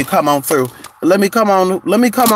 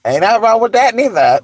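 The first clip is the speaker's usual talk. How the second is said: ordinary delivery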